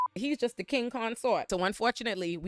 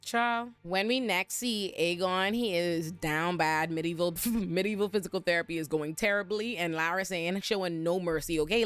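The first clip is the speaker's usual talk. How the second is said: ordinary talk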